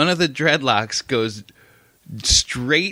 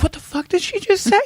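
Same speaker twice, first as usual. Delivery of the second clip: baffled. Retold or imitated. Viewer's baffled reaction.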